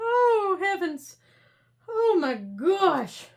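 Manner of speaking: drawn out through laughter; laughing. Real, not acted. Laughing fit.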